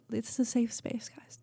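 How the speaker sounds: soft whispered reassurance; hushed safe space whisper. Real, not acted. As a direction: whisper it gently to comfort.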